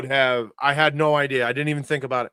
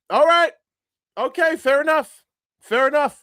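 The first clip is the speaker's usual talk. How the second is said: much higher and louder, giving in; high-pitched concession; 11 semitones higher, 5 dB louder than usual. Real, not acted. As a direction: squeak out a defeated okay. Conceding the prank got him.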